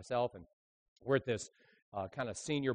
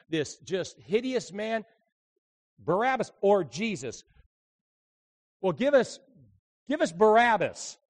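raised, higher, dramatic pulpit delivery; impassioned preaching; 8 semitones higher, 8 dB louder than usual. Acted. Preacher staging the choice between Barabbas and Jesus, impassioned preaching. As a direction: build the scene with forceful, stretched key words.

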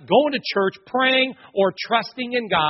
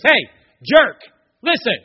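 loud and rough, higher; harsh mock yell; 5 semitones higher, 10 dB louder, slower than usual. Wife yelling at her suffering husband, loud and harsh. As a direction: bark it at him like a fed-up wife.

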